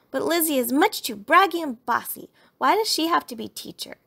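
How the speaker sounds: put-on cross child pleading a case; indignant complaint. A narrator's reading. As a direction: protest the unfairness to mama. Sister complains to mama about Lizzie.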